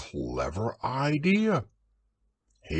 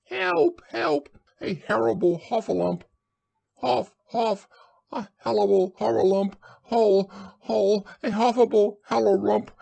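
very high, frantic squeals; panicked Piglet crying out; 12 semitones higher, 4 dB louder than usual. A narrator's reading. Piglet scampers off raising the alarm, high frantic cries. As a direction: cry out in a tongue-tangled panic. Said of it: "still crying out".

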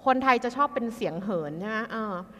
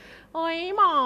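whiny complaint; 6 semitones higher, much slower than usual. Acted. Acted complaint to a doctor.

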